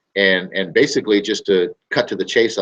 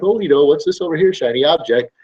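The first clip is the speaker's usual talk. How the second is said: distracted customer; 7 semitones higher than usual. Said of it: "they're like".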